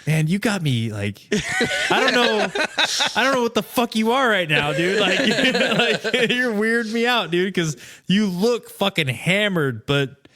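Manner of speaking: loud, high, laughing through the words; incredulous laughing outburst; laughing disbelief. Retold or imitated. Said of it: "And I'm like, I'm like".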